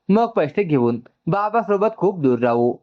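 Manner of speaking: ordinary delivery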